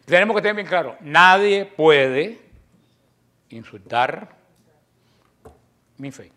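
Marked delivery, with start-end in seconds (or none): 1.0-2.4 nadie puede stretched out and louder; very slow emphatic. Real, not acted. Preacher to listeners, slow heavy emphasis. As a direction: drag out nadie puede with force. Repeats that nobody may insult faith.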